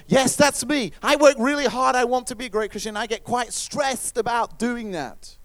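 loud, high-pitched eager confession; stressed striver. Acted. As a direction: act out an overworked, stressed believer. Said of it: "get really stressed".

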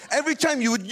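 usual speaking voice

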